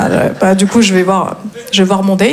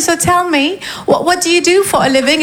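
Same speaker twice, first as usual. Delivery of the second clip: higher, put-on coy English; drague insistante; 6 semitones higher than usual. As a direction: ask coyly in a raised voice. Insiste en anglais avec son date. (anglais minaudé, voix haute)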